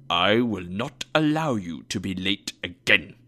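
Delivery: low, stern mock-angry boss; deep, severe pretend reprimand; feigned anger. A narrator's reading. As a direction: lay down the law in a deep put-on voice. Scrooge feigns anger at Bob.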